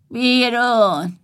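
grumpy. Acted. Grumpy brother throws them out.

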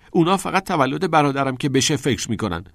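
high, loud resentful complaint; angry. A narrator's reading. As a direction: complain heatedly about being overlooked. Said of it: "با عصبانیت گفت".

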